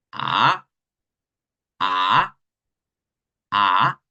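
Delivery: a starting low and weak, then lifting; third tone. Shown as a demonstration. Teacher demoing the third tone on a. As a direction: dip a low then let it rise. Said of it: "La tercera va a comenzar débil".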